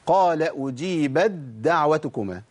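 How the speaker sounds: أجيبت دعوتكما as ujībad-da'watukumā, slow recitation; idgham into dal. Shown as a demonstration. Teacher reciting the second place, أجيبت دعوتكما slowly merged. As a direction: merge the ت of أجيبت into a doubled د, drawn out. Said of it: "إدغام تاء في دال".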